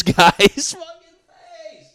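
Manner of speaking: loud, high, slow laugh through words. Real, not acted. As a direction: howl it while cracking up. Laughing loudly at clip.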